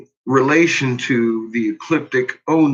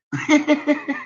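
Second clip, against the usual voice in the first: high laugh; 12 semitones higher than usual. Real, not acted. Laughs at earth flipping.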